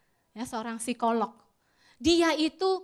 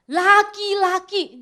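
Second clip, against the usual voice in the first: much louder and very slow, each syllable leaned on; emphatic answer; 6 semitones higher, 13 dB louder, much slower than usual. Acted. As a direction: spell it out for the fathers. Answering who is responsible.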